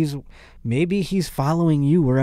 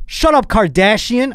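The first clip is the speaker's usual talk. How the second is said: mock snap; 10 semitones higher, 5 dB louder than usual. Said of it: "That would have been me in the room".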